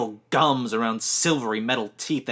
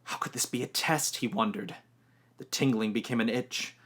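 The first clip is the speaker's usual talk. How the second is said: usual speaking voice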